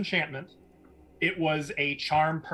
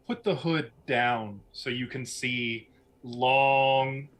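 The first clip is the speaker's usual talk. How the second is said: ordinary talk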